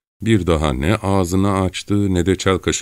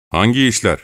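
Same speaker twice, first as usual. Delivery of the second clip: sharp, raised challenge; hard voice; 6 semitones higher, 3 dB louder than usual. Acted. Çelkaş challenging the frightened Gavrila, hard voice. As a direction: demand an answer sternly. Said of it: "Çelkaş sert bir sesle".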